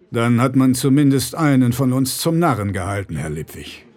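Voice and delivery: a powerful man's cold politeness; bear-like rich man. Acted.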